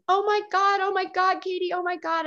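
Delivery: high-pitched panicked exclamation, easing in volume; imitating friend freaking out. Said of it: "freaking out and saying".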